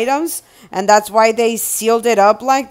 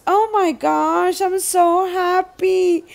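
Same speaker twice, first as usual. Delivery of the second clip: happy; 7 semitones higher than usual. Real, not acted. Happy at box.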